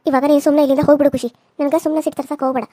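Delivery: furious rapid order; fast loud angry warning to the rival. Acted. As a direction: snap at her to get out, quick and loud.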